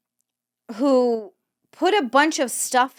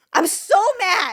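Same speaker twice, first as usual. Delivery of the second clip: frustrated whine; 9 semitones higher than usual. Real, not acted. Real frustration, voice cracking.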